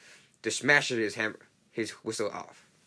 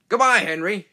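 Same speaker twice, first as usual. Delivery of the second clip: loud parting call; shouted goodbye; 9 semitones higher, 9 dB louder than usual. Acted. Big engine calling goodbye to Henry, loud. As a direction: call it out as he pulls away. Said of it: "shouted Gordon".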